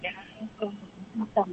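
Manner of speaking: far-off, barely audible answer; too quiet. Real, not acted. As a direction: say it so softly it barely carries. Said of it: "Nói lớn nhìn là nhỏ không nghe?".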